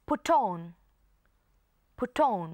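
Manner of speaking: "put on" as one word, "pu-ton"; put on linked. Shown as a demonstration. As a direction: slide the T of "put" into "on". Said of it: "ลากเสียง T มาหา O ด้วย". (อ่าน "put on" เชื่อมเสียง T กับ on)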